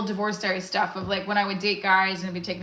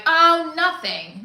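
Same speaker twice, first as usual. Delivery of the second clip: coy oh nothing; 7 semitones higher, 6 dB louder, much slower than usual. Retold or imitated. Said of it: "they'll be like".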